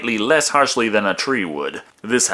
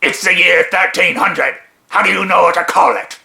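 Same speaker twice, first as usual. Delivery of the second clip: higher, louder, slowed put-on voice; indignant skeleton soldier; 6 semitones higher, 4 dB louder than usual. Skeleton questions comrade's knowledge of cars, indignant. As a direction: challenge your comrade in a raised character voice. Acted skit of bickering undead soldiers.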